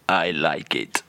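usual speaking voice

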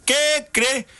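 ¿Qué cree? repetido mucho más agudo, con asombro; qué cree exclamado. Retold or imitated. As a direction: sube la voz en ¿Qué cree?, como quien no lo puede creer. (repite ¿Qué cree? más agudo y asombrado)

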